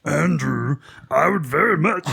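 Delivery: a hint of a gravel-voiced jazz singer reading mail.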